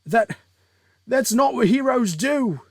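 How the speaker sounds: high, loud panicked stammer; frightened protest. Acted. Wounded villain backing away from the hero, loud and scared. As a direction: yell it in alarm, far above normal pitch. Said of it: "finally showing his fear".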